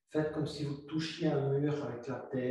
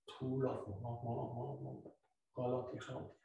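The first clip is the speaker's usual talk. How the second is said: much softer, dying away to near silence; fading to almost nothing; 10 dB quieter, faster than usual. Shown as a demonstration. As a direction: let each word melt into the exhale. Yoga teacher slowing the exhale. (voix qui s'éteint presque complètement)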